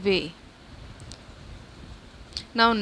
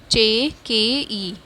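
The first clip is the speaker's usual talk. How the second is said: slow spelling; much slower than usual. Shown as a demonstration. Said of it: "spelling letters for class".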